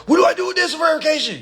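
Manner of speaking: mock-panicked taxpayer; frustrated client. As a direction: parody the panicked caller. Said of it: "folks getting frustrated saying".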